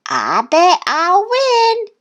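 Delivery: slow, high boastful duck voice; quacked. A narrator's reading. Thistle boasting to Duck and Goose, high quack. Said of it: "quacked Thistle".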